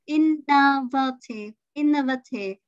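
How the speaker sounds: innovative as IN-nuh-vuh-tive, slow first; innovative, stressed IN, neutral middle vowels; schwa, first-syllable stress. Shown as a demonstration. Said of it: "Ơ nè".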